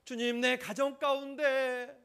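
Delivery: high, loud, slow crying-out prayer; fervent prayer. Acted. Preacher leading the church in prayer for grace, loud slow fervent plea. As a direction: pour out the prayer at full force, slowly.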